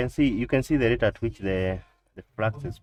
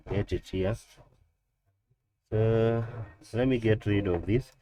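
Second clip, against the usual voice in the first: ordinary delivery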